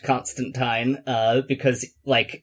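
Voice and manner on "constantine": Constantine with "tine" like the word "sign"; Constantine ending in -tine. Shown as a demonstration. Co-host cites the surprising official version, Constantine ending in -tine. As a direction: pronounce Constantine "CON-stan-tyne". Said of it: "it was supposed to be pronounced".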